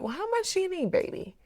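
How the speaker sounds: lightly voiced gentle old man offering help; kind old man. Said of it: "the older man said".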